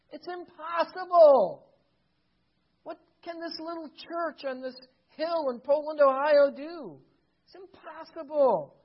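high, loud protest of disbelief; incredulous despair. Acted. Preacher voicing doubters of Nehemiah's prayers, incredulous. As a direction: argue hotly that it is hopeless.

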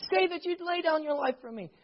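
high, pleading wife voice; lightly voiced exasperated wife. Wife pleading with husband in the kitchen, high and exasperated. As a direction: beg him to just say it. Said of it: "She looks back at him, saying".

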